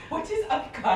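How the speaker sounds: high, stiff mock American vlogger accent; mock robotic American. Teasing a friend by imitating her robotic American accent. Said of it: "Sounds like a robot".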